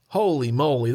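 ordinary talk, nothing marked

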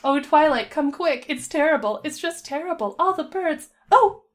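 high, distressed pony voice rising to a startled cry; frantic pony. Acted. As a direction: bring alarming news in a fluster. Panicked pony arrives with bad news.

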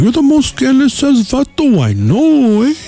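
high, slow mocking coworker voice, drawn out; imitating angry coworker. Coworker Harry scolding him over the pizza, high and drawn out. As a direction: stretch it out in a high sneering voice.

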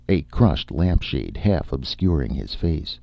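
ordinary delivery

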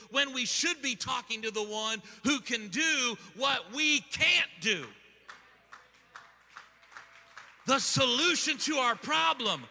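forceful, driving sermon delivery; full-force preaching. Real, not acted. Preacher telling congregation this is you and me, full-force preaching. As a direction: preach it hard, punching each phrase.

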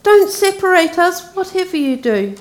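put-on a girl begging not to be split up; Lucy begging the lord, high and pleading. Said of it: "broke in Lucy".

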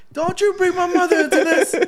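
high, loud, offended outburst; indignant mock shout. Friend acts out the video guy, high loud indignant. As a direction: snap back like he's been insulted.

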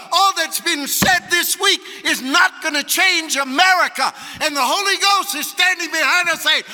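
loud, high, shouted sermon; rally the congregation, punching every phrase; full-force revival preacher; fired-up preaching. Real, not acted.

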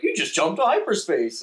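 high, loud tattletale report; alarmed clerk reporting in; flustered Vogon. Acted. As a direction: Vogon calling home planet.

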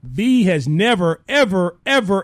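slow, loud, drawn-out proclamation; mock announcement. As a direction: announce it to the folks like big news. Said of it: "We gotta make an announcement".

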